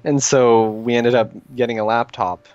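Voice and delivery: usual speaking voice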